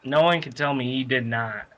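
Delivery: ordinary delivery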